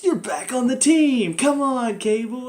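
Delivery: high, slow, hammed-up welcome back; mock cheer. Reviewer mocking the team welcoming Cable back, hammed-up cheer. Mocking the show's quick reunion.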